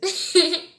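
sudden squealing laugh burst; loud high laugh. Real, not acted.

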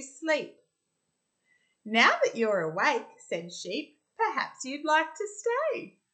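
put-on lively read-aloud for little kids, bright and bouncy; story narration. Teacher reading a rhyming picture book to children, animated.

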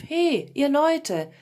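loud, slow, drawn-out hail; calling out. A narrator's reading. King asks people who owns the meadow, calling out. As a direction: call slowly across the meadow. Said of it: "ließ anhalten und rief".

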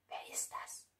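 whispered, barely audible question; hushed suspense. A narrator's reading. Narrator to listeners after the knock at the door, whispered. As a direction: whisper it as if afraid to be heard.

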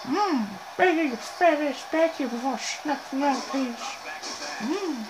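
lightly put-on creepy pervert voice; a hint of a leering cartoon creep, with hummed mm's; Herbert the Pervert. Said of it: "I'm sounding like Herbert the Pervert".

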